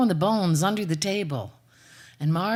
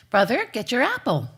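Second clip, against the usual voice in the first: calling out; 5 semitones higher, 5 dB louder than usual. Acted. Calling louder.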